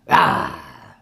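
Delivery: deep throaty mock roar; short low roar; low bear growl.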